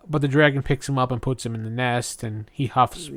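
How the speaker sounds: ordinary talk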